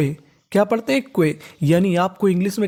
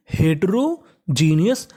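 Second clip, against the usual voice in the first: Heterogeneous slowly, in two separate parts; teacher's slow split of heterogeneous; slowly sounded out; much slower than usual. Shown as a demonstration. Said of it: "जिसे हम लोग speed में बोलेंगे".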